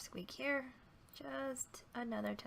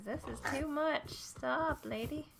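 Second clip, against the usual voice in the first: higher, louder complaining computer voice; voicing her computer; 7 semitones higher, 5 dB louder, slower than usual. Her lagging computer telling her to stop, mock exasperated. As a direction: play the fed-up computer. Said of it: "My computer says".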